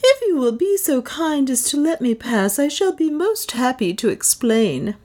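courteous adventurer addressing the crowd; Leonard. A narrator's reading.

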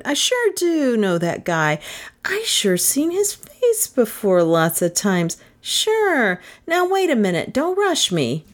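high-pitched, swooping chummy voice; chummy barfly. A narrator's reading. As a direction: pester them as the over-friendly regular. Chummy barfly Bert insists he knows him.

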